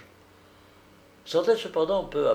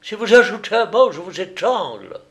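loud, high, fast threat; wild, raised-pitch menace; enraged roar; 9 semitones higher, 7 dB louder than usual. A narrator's reading. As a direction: roar it, beside yourself with fury. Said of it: "rugissait hors de lui".

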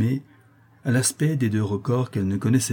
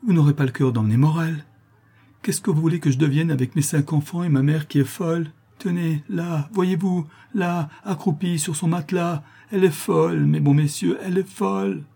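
high, distressed mother's appeal; higher, frantic supplication; desperate pleading; 7 semitones higher than usual. A narrator's reading. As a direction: beg the men for mercy.

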